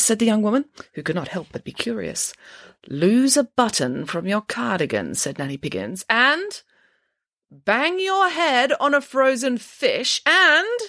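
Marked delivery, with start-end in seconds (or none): 2.9-10.8 slow, high mystic prophecy voice; fortune teller. Acted. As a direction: intone each prediction slowly and grandly. Reads the fortune's predictions.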